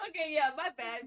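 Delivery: high, loud laughter through the words; laughing hard. Real, not acted. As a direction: laugh out an apology. Player cracks up at game ending.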